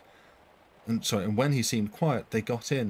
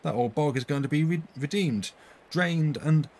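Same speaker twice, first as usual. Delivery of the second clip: excited news; 5 semitones higher, 3 dB louder than usual. A narrator's reading. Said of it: "cried Roger".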